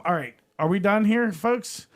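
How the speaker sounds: ordinary talk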